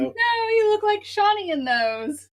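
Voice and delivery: high, slow teasing squeal. Real, not acted. Teasing her partner about his glasses, high squeal. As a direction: squeal the tease at him in a high voice. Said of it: "teasing partner about glasses".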